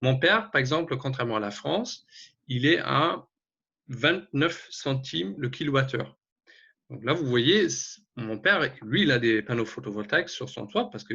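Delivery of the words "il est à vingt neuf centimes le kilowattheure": ordinary talk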